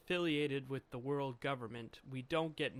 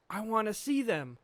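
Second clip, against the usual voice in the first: louder, higher burst of eagerness; eager demand; 6 semitones higher, 6 dB louder than usual. Acted. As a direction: call out like an excited little character. Voices eager Chopper from manga.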